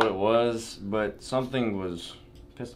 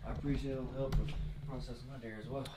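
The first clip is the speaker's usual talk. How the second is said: whispering; 7 dB quieter, faster than usual. Real, not acted. Quiet house, others sleeping.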